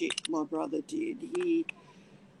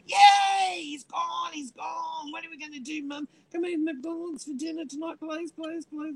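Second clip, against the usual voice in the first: put-on cheering kids; 11 semitones higher than usual. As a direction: celebrate like kids set free, then beg. Kids cheering dad's departure.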